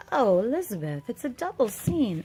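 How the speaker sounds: fairy voice. Pretend. Fairy greets Elizabeth.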